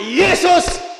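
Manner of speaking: high, loud desperate scream; a man screaming to Jesus for rescue; shouted name. Acted. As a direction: scream the name with everything left. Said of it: "Pada waktu dia teriak".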